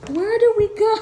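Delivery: high, loud mock bewilderment; voicing confused dogs. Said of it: "they're like".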